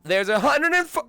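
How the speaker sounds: high, straining voice that fails; voice giving out. Real, not acted. Streamer arguing over vote count, voice giving out. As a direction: push up high until the voice gives out. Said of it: "My voice is still gone".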